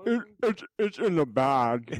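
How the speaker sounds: talking through a mouthful of food; mouth full. Retold or imitated. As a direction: imitate a mouth full of ribs. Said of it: "that's Adam with his mouth full".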